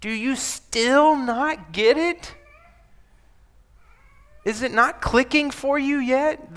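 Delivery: a teacher's gentle frustration; exasperated paraphrase. Shown as a demonstration. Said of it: "is kinda like".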